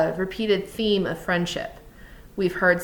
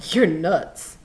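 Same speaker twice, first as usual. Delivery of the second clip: louder, dismissive scorn; scornful; 5 dB louder than usual. A narrator's reading. Crooks rejecting Lenny's rabbit plan, scornful. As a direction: dismiss him flat out with scorn. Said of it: "Crooks was scornful".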